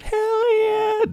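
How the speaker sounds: very high, squeaky hell yeah; squealed cheer. Acted. Host celebrates the first episode with a high squealed cheer. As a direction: squeal it like a hyped kid.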